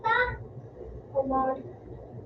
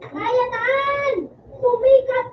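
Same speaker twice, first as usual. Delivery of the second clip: excited shouting; 7 semitones higher, slower than usual. Real, not acted. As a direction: cheer it out.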